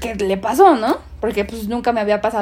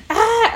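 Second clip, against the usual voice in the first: mock terrified scream; 10 semitones higher than usual. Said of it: "a gritar así de un terror, así".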